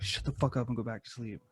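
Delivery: telling the family to go back to sleep, low and soft; low grumble. Retold or imitated. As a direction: mutter it half asleep. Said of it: "I was like".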